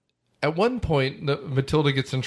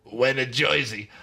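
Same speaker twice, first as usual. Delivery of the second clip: put-on "Joisey" accent; hammed-up New Jersey twang. Shown as a demonstration. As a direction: try out a thick Jersey accent. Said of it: "get more Jersey in that reading".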